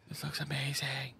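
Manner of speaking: hushed, breath-only whisper of amazement; awed whisper. Real, not acted. Streamer marvelling at the game's visuals, awed whisper. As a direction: whisper it in real awe.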